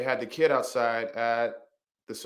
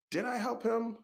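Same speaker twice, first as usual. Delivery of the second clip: high, soft inner question; wondering to oneself; 12 semitones higher, 5 dB quieter than usual. Retold or imitated. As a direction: ask yourself softly, pitched way up. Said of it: "And I thought to myself".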